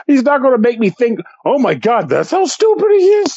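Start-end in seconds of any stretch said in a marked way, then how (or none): 1.5-3.4 mock shock. Mocking own imagined shock.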